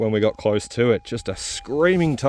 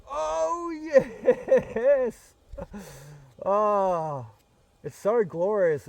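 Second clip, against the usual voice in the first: real delight, much higher, slowing on the last words; thrilled; 8 semitones higher, 4 dB quieter, much slower than usual. Real, not acted. Detectorist hearing a big gold signal, thrilled and high-pitched. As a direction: swoon over the sound of gold.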